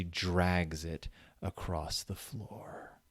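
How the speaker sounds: slow, hushed, voiceless creep; spooky whisper. Acted. Reading the eerie last line to her cohost, slow spooky whisper. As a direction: whisper it like a ghost story ending.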